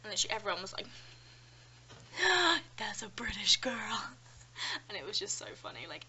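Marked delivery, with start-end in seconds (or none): 1.9-2.7 loud, high mock gasp of amazement. Whole class reacts. 2.8-4.1 slow, hushed whisper of amazement; awed whisper. Retold or imitated. Classmates whisper in awe.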